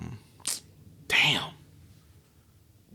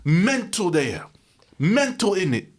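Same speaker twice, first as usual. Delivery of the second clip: high, slow London slang; mock London accent; 9 semitones higher, 5 dB louder, slower than usual. Calling the directors mad, in a mock London accent.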